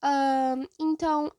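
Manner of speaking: ordinary talk, nothing marked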